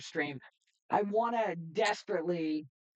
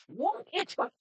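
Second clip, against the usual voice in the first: surprised. Real, not acted. Streamer reacting to his own attack landing, surprised. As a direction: caught off guard by the hit. Move unexpectedly hits in battle.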